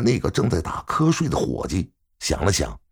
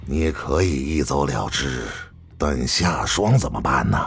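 low-pitched, pressing character voice; reproachful, kept low; 7 semitones lower than usual. A narrator's reading. Li reproaches Yi in dialogue.

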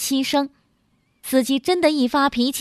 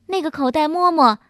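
plain reading voice

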